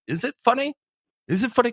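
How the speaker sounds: very high, disbelieving repeated question; panicked high-pitched is it funny; incredulous squeak. Retold or imitated. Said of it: "I'm like".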